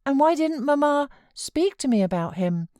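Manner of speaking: put-on a fretful child pleading to stay; sickly child. A narrator's reading. Boy refusing to leave his uncle, high fretful whine. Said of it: "persevered the child".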